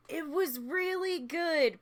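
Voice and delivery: high, slow gush. Real, not acted. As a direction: it was really good, beaming. Gushing about movie.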